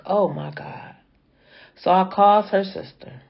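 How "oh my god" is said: hushed unvoiced gasp of shock; whispered oh my God. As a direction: breathe it out in a whisper. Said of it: "I'm like".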